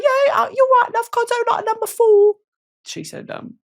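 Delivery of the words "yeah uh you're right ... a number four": a thick mock British accent, high; put-on British accent. Ordering at a UK McDonald's in thick mock British accent. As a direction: order like a Londoner, hamming it up. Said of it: "put it on quite thick".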